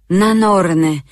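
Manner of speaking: loud, frantic exclamation; desperate oath. Acted. Sigrun swears aloud, trapped before the bear, frantic. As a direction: cry it out in panic.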